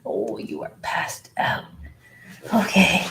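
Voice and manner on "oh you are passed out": whispered coaxing to a newborn pup; soft whisper to puppy. Real, not acted. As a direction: coax the puppy softly.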